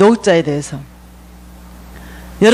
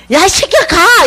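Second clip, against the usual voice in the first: mock angry yell; 8 semitones higher, 3 dB louder, much slower than usual. Homeless man yelling back.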